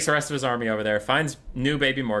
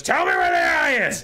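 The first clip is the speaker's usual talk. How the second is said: high, loud screech; strangling demand; 6 dB louder than usual. Acted. Skrulk grabbing the reborn Morgar by the neck, screeched demand. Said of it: "grabs him by his neck".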